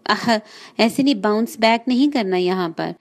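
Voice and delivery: ordinary talk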